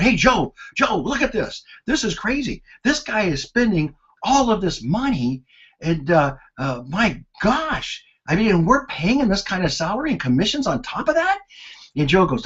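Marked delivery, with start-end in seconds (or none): 0.0-11.4 high, urgent and worked up; excited report. Retold or imitated. Accountant rushing to tell the controller, high and worked up. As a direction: rush in as if you caught a thief.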